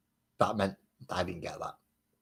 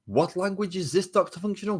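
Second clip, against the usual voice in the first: much higher, louder, hammed-up indignation; mock indignant chat voice; 11 semitones higher, 5 dB louder than usual. Streamer reading a chat comment back, in a much higher mock-indignant voice. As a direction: squeak it out like an offended commenter.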